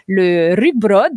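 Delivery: a try at Danish on rugbrød; lightly Danish-flavoured rugbrød; Danish accent attempt. Shown as a demonstration. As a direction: say rugbrød the way a Dane might. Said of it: "désolé pour mon accent".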